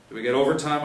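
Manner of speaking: eager question. Acted. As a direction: ask about overtime keenly. Officer asks in briefing.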